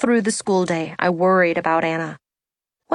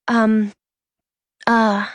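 hesitant stammer. A narrator's reading. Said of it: "Anna stammered".

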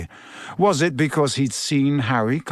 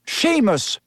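understated roar, pitched up; Professor Lupin; 7 semitones higher than usual. A narrator's reading. Teacher summoning the next pupil, mild narrated roar. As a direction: call the name high, volume held back. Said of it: "roared Professor Lupin".

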